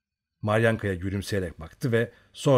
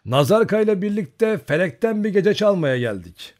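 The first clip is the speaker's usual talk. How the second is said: cheerful boast; 10 semitones higher, 7 dB louder than usual. A narrator's reading. Bold rider's reply.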